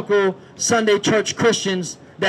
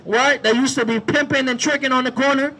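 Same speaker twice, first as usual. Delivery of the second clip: loud, driving sermon shouted to the street; full-force street preaching; 2 semitones higher, 10 dB louder than usual. Acted. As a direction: preach it out over the crowd.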